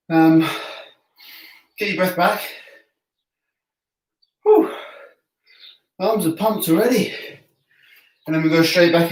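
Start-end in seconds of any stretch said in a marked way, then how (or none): 4.4-4.7 high breathy whew after the set; winded exhale. Real, not acted. Man catching his breath between push-up sets with a whew. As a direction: blow out air, spent.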